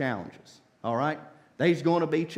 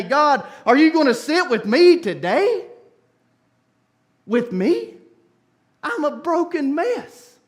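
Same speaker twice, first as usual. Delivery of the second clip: pleading cry; 7 semitones higher, 7 dB louder than usual. Acted. Pleading prayer voiced loudly.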